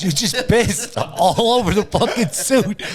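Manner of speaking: slow, high, laughing through the line; laughing quote. Retold or imitated. Retelling his wife's words, laughing slowly. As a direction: crack up while quoting her.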